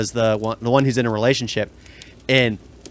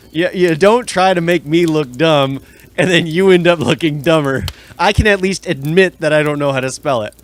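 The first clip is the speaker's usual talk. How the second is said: louder, raised comeback; indignant retort; 3 semitones higher, 4 dB louder than usual. Real, not acted. Friend challenged to spell illiterate hits back, indignant. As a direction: fire back defensively, pushing the key words.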